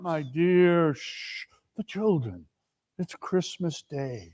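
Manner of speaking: soft, hushed calming; quiet her down gently; hushing. Acted. Bob hushing his wife in front of the children.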